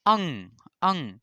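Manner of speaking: अंग drawn out slowly through nose and mouth; nasal demo. Shown as a demonstration. Teacher demoing nasal अंग slowly for students. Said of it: "मुख और नाक दोनों से हवा".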